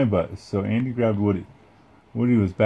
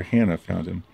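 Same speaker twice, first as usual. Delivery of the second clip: ordinary delivery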